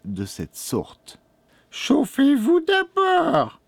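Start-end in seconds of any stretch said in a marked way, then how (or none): 1.7-3.6 very high, slow, sweet witch voice; sugary old crone. A narrator's reading. Old woman invites the boy to warm up first, sugary high crone voice. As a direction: coo it high with false sweetness. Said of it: "répondit-elle d'une voix sucrée".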